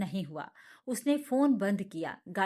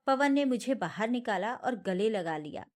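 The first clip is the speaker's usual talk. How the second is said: ordinary delivery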